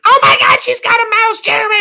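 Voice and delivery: very high, loud falsetto scream; hammed-up screechy alarm; imitated panicked shriek. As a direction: mimic someone shrieking at a mouse. Acting out Evie's panic at mouse.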